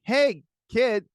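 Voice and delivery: high-pitched, loud exasperated retort; mock lecture to his toddler, pitched high; exasperated dad. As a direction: pitch up, calling the kid out.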